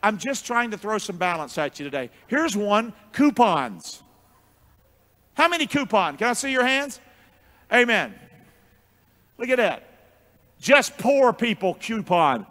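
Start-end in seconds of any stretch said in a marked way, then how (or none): none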